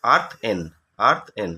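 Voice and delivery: "আর্থ এন" in two separate parts, each falling; teacher sounding out "আর্থ এন" piece by piece; broken into syllables. Shown as a demonstration. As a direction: split "আর্থ এন" with a pause after আর্থ. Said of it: "ভাঙলে কি হবে?".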